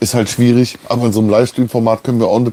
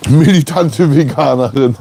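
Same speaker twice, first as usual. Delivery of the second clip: laughing; 6 semitones higher, slower than usual. Real, not acted. Joking about his wife, laughing.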